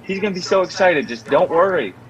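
imitated sister's excited assurance; retold eager reassurance. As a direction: talk her out of worrying, brightly.

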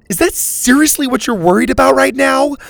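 high, loud exasperated bark; girl barking at injured friend. A narrator's reading. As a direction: snap at him in disbelief. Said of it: "Lenny barked".

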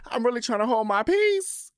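high-pitched mock tearful wail; crying lady. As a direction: imitate a woman pleading through tears. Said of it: "and she's crying".

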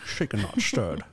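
very low, slow, soft slushy Scottish; Sean Connery. Pretend. Co-host takes turn at impression, deep slow Scottish. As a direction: intone it deep as the Scottish spy. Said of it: "your best Sean Connery".

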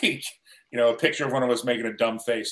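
ordinary talk, nothing marked

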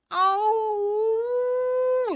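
loud, very high drawn-out oh; shrieking oh, way up high; high loud wail. Retold or imitated. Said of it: "as loud as I could and as high pitched as I could".

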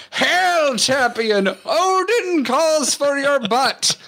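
high, drawn-out Valkyrie proclamation; mock-heroic high-pitched screech; screaming Valkyrie. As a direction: hail them like a hammed-up winged herald. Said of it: "she's just screaming champion every time".

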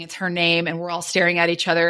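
ordinary delivery

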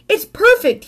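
high, eager cry of delight; a happy little shout of delight; excited shout. A narrator's reading. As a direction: burst out thrilled. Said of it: "he shouted".

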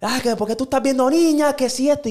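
a hint of an outraged mother; scolding mother. Imitating the mother confronting her son, high and accusing.

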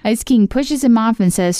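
ordinary talk

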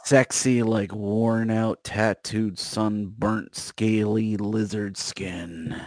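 sarcastic. Real, not acted. Mocking the romantic description.

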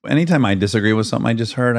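ordinary delivery

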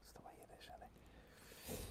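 breathy whisper, almost no voice. Real, not acted. Whispering to his co-host about the early games. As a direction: whisper it like a secret.

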